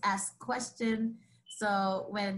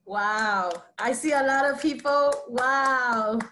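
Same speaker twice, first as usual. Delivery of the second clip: loud, delighted host exclamation; real loud wow of a thrilled host; excited wow; 2 semitones higher, 8 dB louder than usual. Real, not acted. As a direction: burst out in real amazement. Host reacts to the poem.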